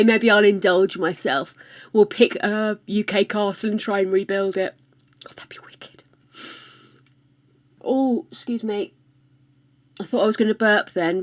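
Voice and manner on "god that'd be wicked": soft unvoiced whisper. Real, not acted. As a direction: breathe it as a secret. Whispered aside.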